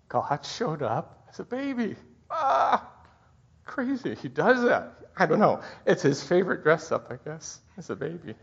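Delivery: high, tearful wonder; emotional. Real, not acted. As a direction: overcome with real emotion while preaching. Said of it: "I got all emotional".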